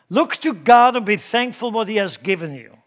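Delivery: loud, high, ringing sermon delivery; impassioned preaching. Real, not acted. Preacher exhorting the church not to compare gifts, full-force preaching. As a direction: preach it hard, stretching the key words.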